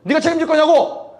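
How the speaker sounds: high, heated retold comeback; rude angry retort. Lifeguard throws past drownings at preacher, high angry retort. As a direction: imitate an aggressive man pressing his point. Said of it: "말이 심하시다고".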